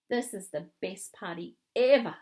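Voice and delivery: loud, delighted declaration; excited exclamation. A narrator's reading. Ella exclaims at the party.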